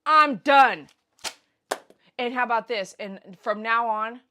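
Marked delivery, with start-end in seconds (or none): 0.0-0.9 loud, emphatic declaration; raised-voice quitting; acted-out walkout. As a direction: announce it loudly and finally. Acts out walking out.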